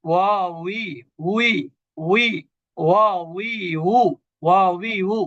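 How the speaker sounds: "ওয়া উই, উ" drilled syllable by syllable with a w glide; letter drill. Shown as a demonstration. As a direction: repeat "ওয়া উই, উ" with w as in English we. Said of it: "এটাকে আমরা এভাবে যদি বলি". ("ওয়া উই, উ" ইংরেজি উই-এর মতো টেনে টেনে উচ্চারণ)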